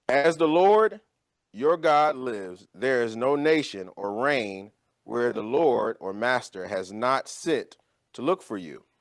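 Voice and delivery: slow and weighty, every word given its full time; slow solemn oath. Acted. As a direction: lay each word down like an oath before God. Elijah's oath, retold to Obadiah.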